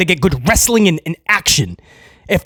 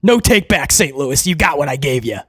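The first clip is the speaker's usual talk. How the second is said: mock Hunter, low; 6 semitones lower than usual. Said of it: "And Hunter's like".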